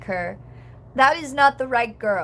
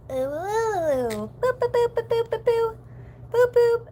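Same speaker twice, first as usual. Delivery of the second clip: string of high-pitched boop sounds; playful silly noises; 10 semitones higher than usual. Pretend. Streamer amusing the chat, silly high boops.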